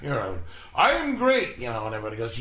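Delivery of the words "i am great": high, loud, grandiose declaration; big pompous announcement, sent up; mock boast. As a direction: proclaim it with hammed-up self-importance.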